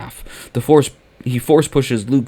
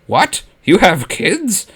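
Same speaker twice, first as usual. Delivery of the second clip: surprised; 7 semitones higher, 3 dB louder, slower than usual. Acted. Emperor learns of Vader's children.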